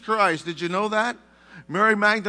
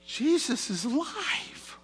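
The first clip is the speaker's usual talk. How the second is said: high gentle angel question; 7 semitones higher, 7 dB quieter, slower than usual. Retold or imitated. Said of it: "for them, it would be natural".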